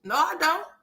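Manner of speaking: put-on high-pitched girlish no; high denial. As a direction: squeak out a mock denial. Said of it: "I'm like".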